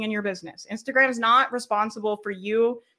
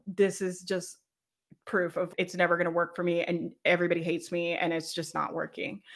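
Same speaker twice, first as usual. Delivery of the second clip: low, softer, mildly dejected; mock defeat; 4 semitones lower, 6 dB quieter than usual. Coach voicing the losing story, lightly dejected. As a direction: let it sink like someone half giving up.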